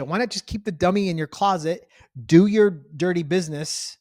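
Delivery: ordinary delivery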